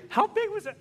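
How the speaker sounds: high, loud wife question. Retold or imitated.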